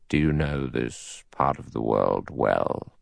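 low, slow, soft judge voice; deep and deliberate, weighing the question; cautious judge. A narrator's reading. Judge questions fellow passenger, slow and cautious.

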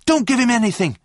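high, loud urgent protest; shouting. A narrator's reading. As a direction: shout a frantic warning. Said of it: "shouted John".

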